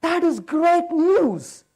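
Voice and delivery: a slow, drawn-out, put-on "wow, that is great news"; mock delighted wow. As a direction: fake cheer, stretched out slowly. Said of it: "I said".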